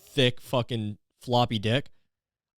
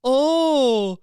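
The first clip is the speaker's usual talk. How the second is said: put-on high, slow, sing-song baby voice; sarcastic pity in a high cooing voice; mocking baby talk; 7 semitones higher, slower than usual. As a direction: coo mockingly at your own whining self. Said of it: "mocking myself".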